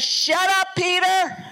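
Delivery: high, loud mock rebuke; God cutting in. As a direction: snap at Peter to stop talking. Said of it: "And God says".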